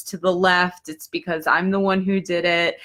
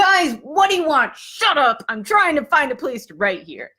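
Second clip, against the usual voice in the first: raised, high exasperated plea to be left alone; fed-up, higher-pitched retold yell; 6 semitones higher than usual. Student telling noisy crows off, exasperated.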